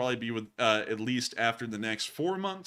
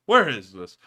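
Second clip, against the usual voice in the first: same question called out louder; louder repeat; 10 dB louder than usual. Real, not acted. Host repeating to himself, louder the second time.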